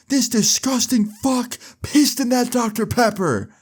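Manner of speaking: high-pitched outraged yell, retold; imitated yell. Joey outing Kyle to the whole party, imitated high yell. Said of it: "And Joey yells out".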